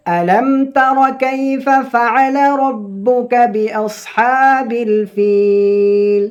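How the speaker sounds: slow, high melodic chanting of the verse; Quran recitation. Shown as a demonstration. Teacher opening the Surah lesson with slow high recitation.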